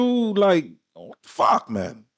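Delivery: ordinary talk, nothing marked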